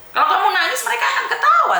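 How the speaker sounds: high-pitched, exasperated warning; urgent tough-love lecture; emphatic scolding. Real, not acted. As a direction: drive the warning home, pitch soaring. Host scolds crying caller to toughen up.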